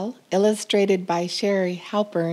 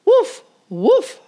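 high, loud, proud woofs; happy barking; 14 semitones higher, 7 dB louder than usual. A narrator's reading. Titus barking back at the cheering farm. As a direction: bark with pride. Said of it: "barked Titus".

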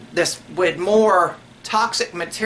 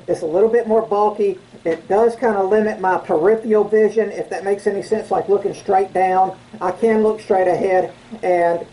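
muffled behind face mask; speaking inside half mask; through a respirator mask. Real, not acted. Seen 1: half-face respirator worn over mouth and nose throughout.

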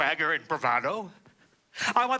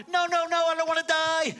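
sniveling wail; 10 semitones higher than usual. Retold or imitated. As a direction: squeal like a child begging for his life. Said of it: "begins to cry and wail and snivel and shouts out".

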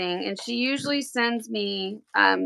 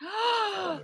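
a sudden high gasp of surprise; overwhelmed gasp at the custom logo; sharp gasp; 10 semitones higher, 5 dB quieter than usual. Real, not acted. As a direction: gasp as the gift is revealed. Opening personalized gift.

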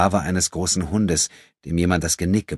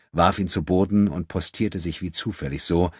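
ordinary delivery, nothing marked

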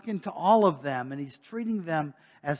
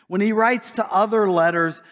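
ordinary delivery

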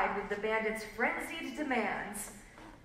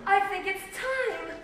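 higher-pitched, mildly sighing voice; sighed line; 7 semitones higher than usual. A narrator's reading. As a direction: sigh it as the pregnant woman. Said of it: "sighed the pregnant woman".

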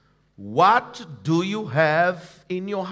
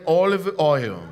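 OLIVE OIL said again, fuller and louder; louder repeat; 3 semitones lower than usual. Shown as a demonstration. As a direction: push it out for the church to echo. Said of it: "Say again".

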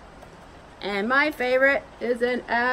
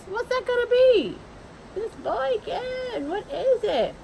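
put-on high, eager child-like wondering; bright high voice asking what the drawing is; excited guessing; 12 semitones higher than usual. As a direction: play the curious kid, thrilled to guess.

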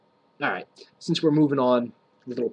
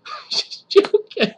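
laughing; 9 dB louder, much slower than usual. Real, not acted. Laughs off his own joke.

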